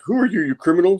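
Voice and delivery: high-pitched mock police demand; accusing cop. Cops challenge the hanging woman.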